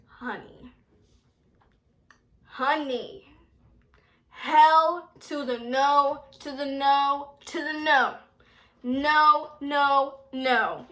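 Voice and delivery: loud, drawn-out hell to the no; sassy friend telling a guy no, loud and slow; sassy refusal. Retold or imitated. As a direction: shut him down with sass. She flatly refuses a date's suggestion.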